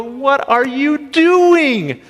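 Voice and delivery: high-pitched, slow, exasperated grandma scolding; storming grandma. Retold or imitated. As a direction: scold the muddy kids like a shocked grandma. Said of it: "she came storming out that door and go".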